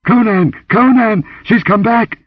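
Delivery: high, loud cry for help; terrified shriek. A narrator's reading. Dancer calls to Conan from the oracle chamber, shrieking. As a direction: scream his name in alarm. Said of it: "The shriek ended in a gurgle".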